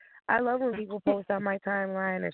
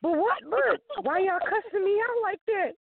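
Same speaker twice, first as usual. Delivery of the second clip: higher, louder, put-upon disbelief; mock indignant; 10 semitones higher, 4 dB louder than usual. Author protesting to co-author, hammed-up high indignant. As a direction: play wounded innocence, hammed up. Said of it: "I'm like".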